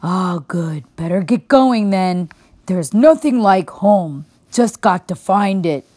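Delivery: put-on a drowsy old bear asking the way; sleepy old bear. A narrator's reading. Old bear asks the girl which way, drowsy.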